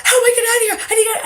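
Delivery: high, loud panic, gasping for a way out; frantic, trapped under the bus; panicked. Retold or imitated. Retelling her escape from under the bus, panicked and loud.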